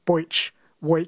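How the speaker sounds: "boich, week" with "oi" for the ee vowel; E sound as oi. Shown as a demonstration. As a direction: say "week" like "woik", matching "boich". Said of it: "pronounce the E sound as an oi".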